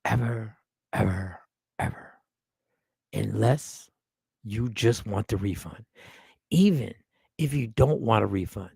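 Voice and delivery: low, slow, deliberate delivery; deep, measured and emphatic; grave warning. Real, not acted. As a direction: spell out the warning slowly, with long pauses. Warning viewers off a word.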